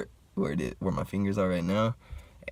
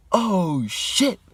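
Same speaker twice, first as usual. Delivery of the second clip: loud, very high mock alarm; shocked exclamation; 12 semitones higher, 6 dB louder than usual. Homie looks at the bleeding head, high loud shock. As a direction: imitate a friend's shocked outburst.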